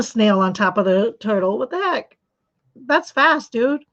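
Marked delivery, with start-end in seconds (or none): 1.6-3.8 amazed disbelief. Real, not acted. Amazed by a viewer's knitting speed.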